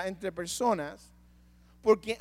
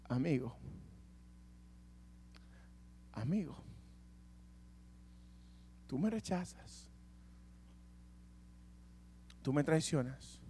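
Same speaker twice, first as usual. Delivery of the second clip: much softer and lower, pleading love; tender hushed Jesus; 8 semitones lower, 14 dB quieter than usual. Acted. Jesus speaking softly to Judas before the betrayal. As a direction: say it as a wounded friend who still loves. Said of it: "lo primero que te dice es".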